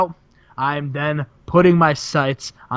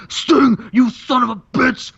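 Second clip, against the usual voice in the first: furious wrestler; 6 semitones higher than usual. Retold or imitated. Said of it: "Savage is like".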